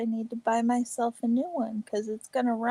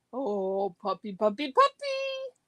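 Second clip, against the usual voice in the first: talking to a dog. Real, not acted. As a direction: coo for the puppy. Calling the dog.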